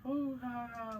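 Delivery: high-pitched put-on coo; playful fawning. Charming old ladies to make their day, playful fawning.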